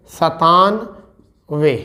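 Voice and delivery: each syllable slowly drawn out; slow dictation. Shown as a demonstration. Teacher dictating numbers to students, slow and drawn out. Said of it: "इसे कहते हैं".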